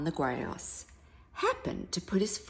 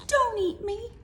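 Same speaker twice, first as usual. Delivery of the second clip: begging mouse; 5 dB louder than usual. Acted. Mouse begging the hungry lion not to eat her, high and pleading. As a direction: beg for your life in a tiny high voice. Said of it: "begged the mouse".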